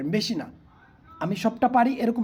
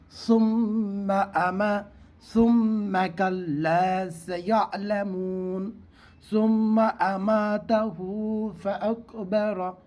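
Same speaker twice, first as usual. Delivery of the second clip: Quran recitation; much slower than usual. Shown as a demonstration. Teacher recites the verse to show students. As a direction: recite the verse slowly, melodically. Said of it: "এইভাবে".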